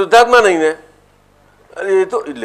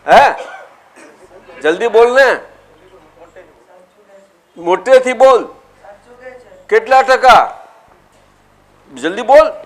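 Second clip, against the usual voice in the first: pressing; 6 semitones higher, 7 dB louder than usual. Real, not acted. Guru pressing child to answer.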